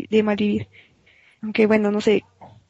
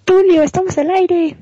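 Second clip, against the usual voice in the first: calling out; 7 semitones higher, 6 dB louder than usual. Real, not acted. Calling out to Tulio.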